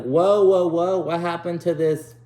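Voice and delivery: high, loud, incredulous whoas; mock astonishment. Viewer calling out Sarah for acting hot and bothered, mock astonished. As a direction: call her out with exaggerated disbelief.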